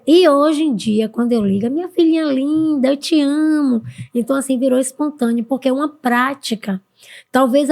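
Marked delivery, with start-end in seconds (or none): 1.7-3.8 higher, sing-song doting imitation; affectionate mother. Mother greeting her daughter on the phone, imitated affectionately. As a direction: gush fondly like a doting mum.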